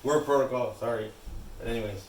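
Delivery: muffled behind a mask; talking through a mask; through a face mask. Real, not acted. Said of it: "excuse my, my mask".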